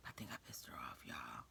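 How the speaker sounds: low whisper to the viewers; whispered aside. Real, not acted. Admitting to viewers she angered the aide, whispered aside. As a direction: confide it under your breath.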